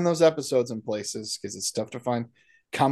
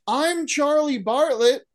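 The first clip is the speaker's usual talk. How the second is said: high, slow, drawn-out stage greeting; Charlie Bartlett; 11 semitones higher, much slower than usual. Acted. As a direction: imitate a kid introducing himself to a crowd. Imitating character's stage greeting.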